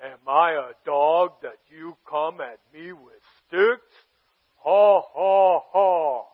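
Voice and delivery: low, slow giant with a mocking laugh; dopey cartoon bellow. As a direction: sneer down at the boy, then laugh. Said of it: "the giant says this to him".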